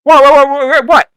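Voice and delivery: high, loud burst of disbelief; startled brawler. Acted. Brawler to the opponent who called wait, loud and startled. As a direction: sputter it, thrown off mid-fight.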